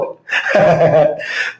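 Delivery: short chuckle at own joke; breathy, voiceless chuckling; amused laugh. Real, not acted. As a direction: laugh at the silly wordplay.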